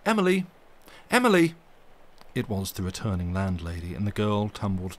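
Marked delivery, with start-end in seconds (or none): 0.1-1.5 high, loud calling from below; shrill call. A narrator's reading. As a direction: call her name up the stairs. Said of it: "a shrill voice calling her name".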